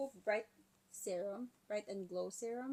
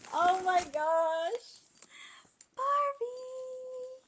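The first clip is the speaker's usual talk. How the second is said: loud, high, drawn-out delight; real thrilled, high-pitched gushing; excited squeal; 13 semitones higher, 9 dB louder, much slower than usual. Real, not acted. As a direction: squeal over a gift you love. Squeals over Barbie gift.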